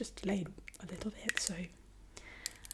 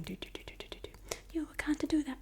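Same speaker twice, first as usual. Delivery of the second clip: put-on Italian accent; 8 semitones higher, faster than usual. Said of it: "She's going".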